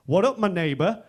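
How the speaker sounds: loud, rising buddy greeting; bold mock greeting. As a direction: belt out the greeting like a daring mate. He returns greeting, bolder.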